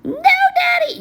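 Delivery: very high mock wail of a little girl; hammed-up child's cry for daddy. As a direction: squeal it like a sobbing child. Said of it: "crying her little eyes out".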